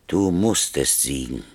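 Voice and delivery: ordinary talk, nothing marked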